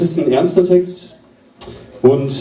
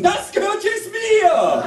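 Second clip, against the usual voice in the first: slow, very high triumphant cry; greedy shout; slower than usual. Acted. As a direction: shout it out, drawn long and high. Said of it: "und rufen".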